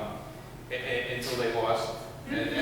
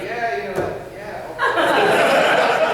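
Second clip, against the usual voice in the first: high, slow laughing fake agreement; mock nodding along; 8 semitones higher, 8 dB louder, much slower than usual. To the panel, imitating himself faking understanding, laughing. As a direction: drag out the okay while cracking up. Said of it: "I was like".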